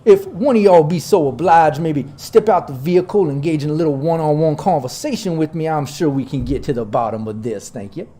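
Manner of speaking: a folksy colonel drawling his welcome; Southern drawl. Acted. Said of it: "I like the sound of your southern charm".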